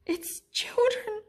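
scared whisper, pitched high; a terrified little monster's squeaking whisper; breathless, frightened, high whisper. A narrator's reading. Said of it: "squeaked Pog".

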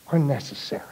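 breathy hushed whisper to end the warning. Acted. Priest at the deathbed, whispering the last words. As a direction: drop to a whisper at the bedside.

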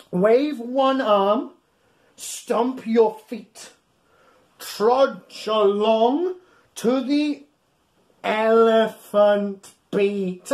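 slow, sing-song rhyme read aloud; reading to children. Acted. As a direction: read each beat out slowly for little ones.

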